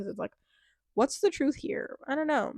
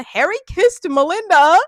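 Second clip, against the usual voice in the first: loud, high gossip announcement; excited reveal; 8 semitones higher, 12 dB louder than usual. Real, not acted. Recapping the show for viewers, loudly excited. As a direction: drop the bombshell loudly.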